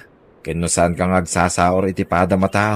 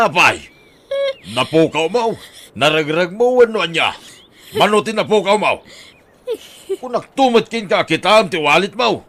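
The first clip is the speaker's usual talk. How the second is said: angry scolding; 10 semitones higher than usual. Acted. Husband scolds wife for losing the money, furious. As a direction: berate her, pitch climbing.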